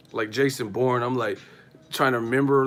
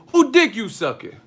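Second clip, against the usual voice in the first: higher, louder jealous yell; loud mock accusation; 10 semitones higher, 7 dB louder than usual. Girlfriend yelling at her man in the hotel, mock loud yell. Neighbors arguing through hotel wall.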